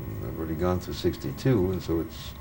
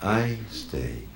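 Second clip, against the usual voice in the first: slow, softer, spaced-out recitation; poem reading; 5 dB quieter, slower than usual. Acted. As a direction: let each line hang in silence, quietly. Reads short poem Guru.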